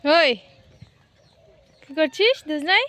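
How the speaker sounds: loud call across the yard. Real, not acted. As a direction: call out to them from afar. Calling out to siblings.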